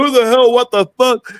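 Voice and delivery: imitating a shocked big guy, voice jumping up; startled. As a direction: caught off guard mid-zip. Billy startled while zipping up.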